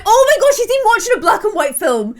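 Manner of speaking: high, loud delighted exclamation; amused amazement. Real, not acted. Gamer spotting Jace watching old film in a face mask, amused amazement. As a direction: burst out, tickled and incredulous. Amused discovery of Jace's odd evening.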